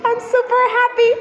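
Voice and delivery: super happy. Pretend. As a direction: squeal it out like a delighted bunny. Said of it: "I want to be a happy rabbit".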